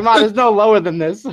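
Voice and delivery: high, loud retold protest. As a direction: plead with Mom in exasperation. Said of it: "And I'm like".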